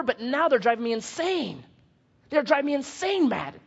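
exasperated parent. Acted. Parent tells Matt about the children, acted exasperation. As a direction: complain as a parent at wit's end.

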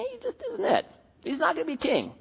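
high, soft whiny sigh; lightly whimpering father giving up; mock lament.

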